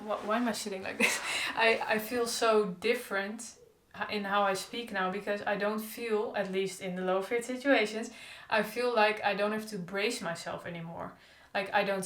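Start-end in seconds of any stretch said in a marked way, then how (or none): none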